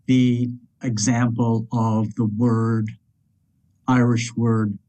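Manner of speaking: ordinary talk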